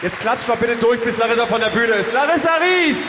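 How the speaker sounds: high, loud announcer shouts; grand entrance announcement. Acted. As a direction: hype the exit like a gala host. Verabschiedet Gast mit Ausrufen. (große Ansage mit Ausrufen)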